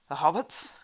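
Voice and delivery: loud alarmed question, rising; cried out. Acted. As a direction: blurt it out in alarm. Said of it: "cried Gimli".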